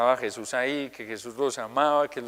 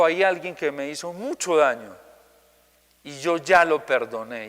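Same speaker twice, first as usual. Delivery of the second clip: normal talking voice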